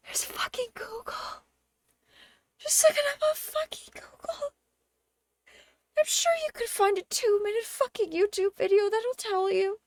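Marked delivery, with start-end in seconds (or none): 0.1-8.1 whispered, higher, fed up; exasperated whisper. Retold or imitated. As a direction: whisper it in disbelief, almost crying. Exasperated at tourists.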